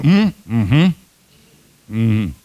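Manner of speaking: a lightly mimed husband's bored grunts; mock noncommittal hums; grunting husband. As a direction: grunt back without really answering. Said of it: "Ele fala".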